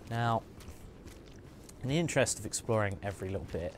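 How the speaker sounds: ordinary talk, nothing marked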